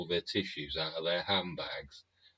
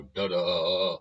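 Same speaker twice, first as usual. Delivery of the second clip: a loud sung-out ta-da reveal; loud mock ta-da for the new bomber; mock fanfare; 8 dB louder than usual. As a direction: announce it like a triumphant fanfare. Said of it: "they'll just go".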